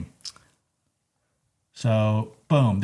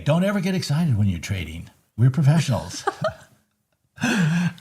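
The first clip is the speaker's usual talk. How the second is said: normal talking voice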